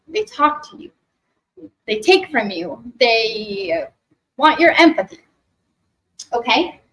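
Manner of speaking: ordinary talk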